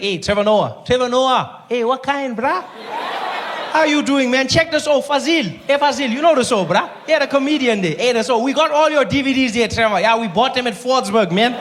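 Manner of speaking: lightly voiced South African Indian guy. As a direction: chat him up like an excited fan with an Indian lilt. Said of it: "Indian guys".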